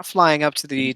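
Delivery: ordinary talk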